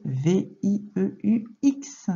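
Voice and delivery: slow, separated spelling; letter by letter. Shown as a demonstration. Teacher correcting a pupil's dictation, letter by letter. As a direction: spell it out with gaps between letters.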